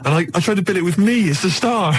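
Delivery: ordinary delivery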